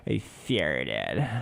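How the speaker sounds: put-on low drawn valley girl "I farted"; lazy valley girl drawl, I as eh; valley girl accent. Teller imitating the valley girl at Walmart, drawled accent. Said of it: "Valley girl straight up".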